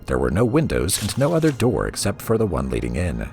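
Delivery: ordinary delivery, nothing marked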